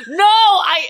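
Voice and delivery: high, loud, laughing through words. Real, not acted. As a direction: giggle while asking it. Laughing at own reaction.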